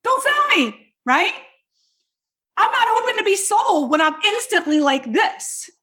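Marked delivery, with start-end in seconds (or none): 0.0-0.9 high, slow yelp of a shopper recoiling; hammed-up fearful shout; mock panic. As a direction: cry it out like a startled customer. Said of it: "It's like".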